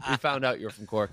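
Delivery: normal talking voice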